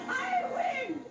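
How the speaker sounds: high shrieking triumph; triumphant shout. Acted. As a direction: cackle with glee at winning. Witch celebrates her victory.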